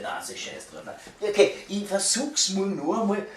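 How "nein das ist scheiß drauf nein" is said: whispered mutter. Real, not acted. Frustrated aside to himself.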